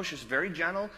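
ordinary delivery

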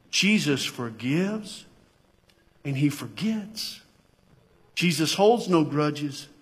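slow, measured statement; slow, steady preaching emphasis; deliberate assurance. Real, not acted. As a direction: space the words out so each lands. Driving the point home gently.

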